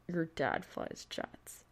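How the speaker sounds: stunned statement. Acted.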